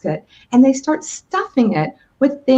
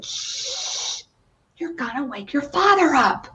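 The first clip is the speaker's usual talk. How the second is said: hushing; 3 semitones higher, 5 dB quieter, slower than usual. Acted. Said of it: "Mother Mouse came running over".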